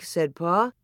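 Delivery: plain reading voice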